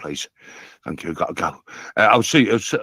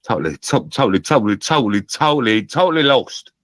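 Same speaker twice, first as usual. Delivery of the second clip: repeating totally louder each time; building frustration; 5 semitones higher, 5 dB louder than usual. Real, not acted. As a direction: build from a mumble to an exasperated cry.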